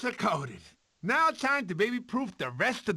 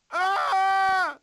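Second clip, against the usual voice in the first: high, loud yelp of fright; scared scream; 11 semitones higher, 5 dB louder than usual. Acted. Sid fleeing a dinosaur, screaming. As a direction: shriek as something lunges. Sloth in danger.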